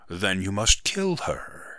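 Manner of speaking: plain reading voice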